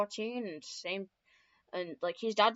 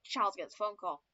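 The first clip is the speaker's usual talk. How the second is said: usual speaking voice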